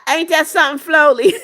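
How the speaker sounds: can't hold the laugh in. Real, not acted. Laughing at [name] comment.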